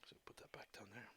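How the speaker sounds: breathy unvoiced whisper. Real, not acted. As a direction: whisper it to yourself. Muttering to himself while drawing.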